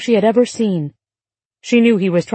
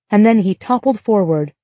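ordinary delivery

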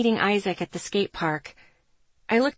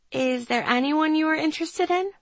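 higher, careful questioning tone; 8 semitones higher than usual. A narrator's reading. Friend asking if she likes anyone, careful and high. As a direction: ask carefully, pitched up and rising at the end. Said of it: "she asked carefully".

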